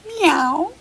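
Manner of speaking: high mewing meow; playful kitten meow; kitty meow. Shown as a demonstration. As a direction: meow like a little cat. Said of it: "what does the kitty say".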